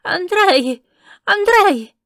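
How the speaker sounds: high, very loud calls across the yard; an old madwoman screeching for the servant; shrill-pitched old woman's cry; croaking shout. A narrator's reading. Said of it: "gridò con voce gracchiante".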